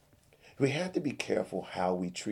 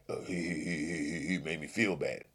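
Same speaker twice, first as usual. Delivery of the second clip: mock whine; 3 semitones lower, 5 dB quieter than usual. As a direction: mimic his sulk. Mocking Billy's complaint.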